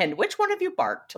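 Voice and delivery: high, sing-song mock sitcom mom. [name] quizzing the boys about the bark, high sitcom voice. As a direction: play it like an eighties TV mother.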